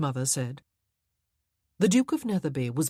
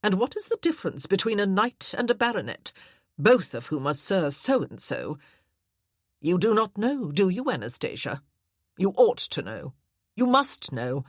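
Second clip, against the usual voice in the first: louder, pressing interrogation; fussy aunt quizzing; 3 semitones higher, 5 dB louder than usual. Acted. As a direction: fire the questions at her. Aunt Matilda presses Anna.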